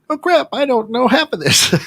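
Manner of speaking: high-pitched comic alarm; mock panic. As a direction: play sudden dismay, voice shooting way up. Acts out sudden panicked realization.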